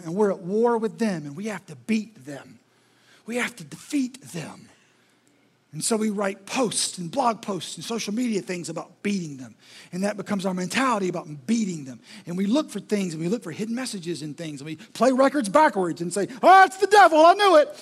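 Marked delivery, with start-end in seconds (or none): 1.2-4.7 a soft conspiratorial hush; sinister hushed. Acted. Mocking war mentality. 16.4-17.7 high, loud mock alarm; squealing "I knew it"; mock gasp of discovery. Mocking Christians finding devil.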